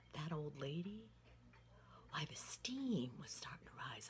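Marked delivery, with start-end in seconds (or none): none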